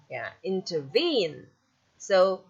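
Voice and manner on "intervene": "intervene" with two light syllables, then a strong VENE; final-syllable stress. Shown as a demonstration. Teacher repeating the anapest example, final stress on "intervene". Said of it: "stress, you have one".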